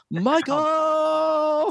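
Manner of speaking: high, stretched-out name call like a ring announcer; lightly hammed-up announcer calling Michael; mock announcer name. Friend mimicking the announcer saying a name, playful. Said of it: "have him say your name".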